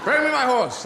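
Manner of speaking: loud, high mock-Caesar order; commanding call. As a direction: call it out like a Roman leader. Caesar acted, ordering his horse.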